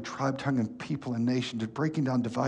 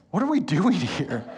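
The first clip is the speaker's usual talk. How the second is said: laughing disbelief; 5 semitones higher, 4 dB louder than usual. Retold or imitated. Kid self reacting, laughing.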